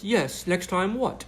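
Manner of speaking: high, slow mock British reporter; mock British accent. Reporter's DM read slowly in a mock British accent. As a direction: drag it out in a hammed-up British accent. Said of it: "I'm doing her British accent".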